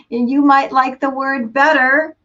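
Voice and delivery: ordinary talk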